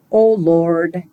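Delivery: Oh Lord in plain American; American accent, a simple spoken invocation; American Yankee accent. Shown as a demonstration. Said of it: "in an American Yankee accent".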